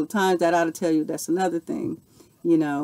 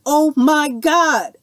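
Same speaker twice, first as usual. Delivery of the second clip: higher, louder, slow drawn-out amazement; awestruck oh my God; 9 semitones higher, 9 dB louder, much slower than usual. Real, not acted. Telling viewers her soulmate appeared, slow and amazed. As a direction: let the wonder spill out slowly.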